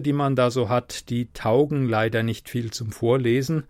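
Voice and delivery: ordinary delivery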